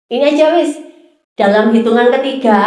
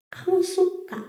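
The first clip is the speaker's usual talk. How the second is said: soft reveal; 7 semitones higher, 12 dB quieter than usual. Real, not acted. Punchline of sermon point.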